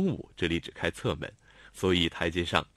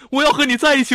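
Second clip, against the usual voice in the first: louder tearful shout; 13 dB louder, faster than usual. A narrator's reading. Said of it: "她红着眼叫喊".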